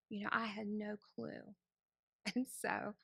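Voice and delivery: ordinary talk, nothing marked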